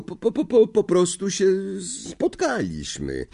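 high, frightened stutter; stammering. A narrator's reading. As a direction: stutter out an excuse. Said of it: "wyjąkał".

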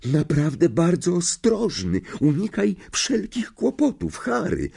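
high, slow, alarmed schoolgirl voice; anxious girl. A narrator's reading. Hermiona frets over Harry, alarmed high voice.

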